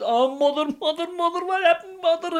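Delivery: high, mock lament; hammed-up wailing. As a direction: wail theatrically over her. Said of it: "he fell down on his knees".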